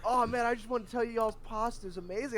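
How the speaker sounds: mock the drunk man with a terrible Italian accent; bad Italian accent. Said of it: "Just the worst Italian accent ever".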